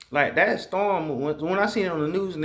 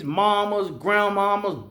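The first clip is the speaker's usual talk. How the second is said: ordinary talk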